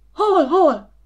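high, loud, flustered questioning; jolted from half-sleep, casting about in alarm; startled alarm. A narrator's reading. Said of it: "kapkodott ilyetten még félállomban Ilus".

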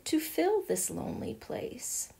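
a wistful whispered sigh. A narrator's reading. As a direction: breathe it out sadly, nearly unvoiced. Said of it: "she sighed".

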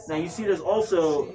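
ordinary talk

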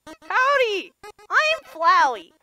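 lightly voiced cheery flower; a hint of a chipper little flower; Flowey. Voices Flowey reading his lines.

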